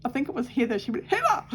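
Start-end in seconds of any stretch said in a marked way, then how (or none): 1.1-1.4 mock scolding cry. Mother scolds sister's joke.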